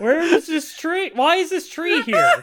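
high, laughing disbelief. Real, not acted. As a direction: laugh through the question. Laughing disbelief at tree scene.

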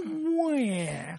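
high mock baby wail; crybaby wail. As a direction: fake little cry. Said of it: "a big old crybaby".

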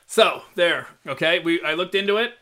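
ordinary talk

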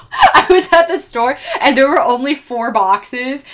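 giggling through the words; laughing. Real, not acted. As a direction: laugh while telling it. Laughing about ginger snaps.

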